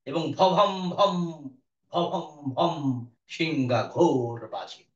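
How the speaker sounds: ভভম ভম with a strong puff on each ভ, slowly; slow breathy aspirated bh. Shown as a demonstration. Teacher demonstrating aspirated ভ, slow and breathy. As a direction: push air hard through each ভ in ভভম ভম. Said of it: "এই যে মহাপ্রাণ করছি আমি".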